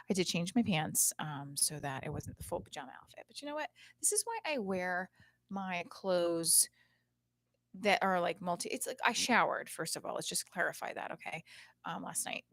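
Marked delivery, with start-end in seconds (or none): none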